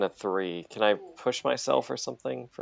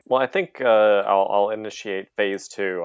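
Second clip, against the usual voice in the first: ordinary delivery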